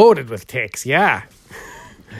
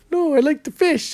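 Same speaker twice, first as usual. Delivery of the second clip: high quoted reply; imitated customer; 9 semitones higher, 3 dB quieter than usual. Carnival customer turns down the tennis guess, high imitated voice. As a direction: lift the pitch right up for the customer's answer.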